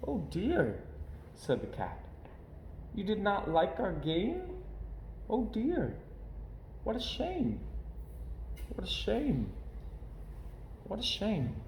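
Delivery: a cat let down by the rejection; mildly hurt, quiet regret; sad Cat. A narrator's reading. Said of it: "went away with a sad kind of look".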